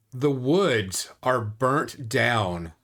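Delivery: ordinary talk, nothing marked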